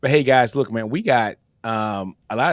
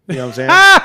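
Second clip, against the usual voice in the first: shouted rhetorical question, much louder and higher; loud playful shout; 7 semitones higher, 14 dB louder than usual. Real, not acted. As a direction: call it out big to the viewer. Teasing a viewer about lateness.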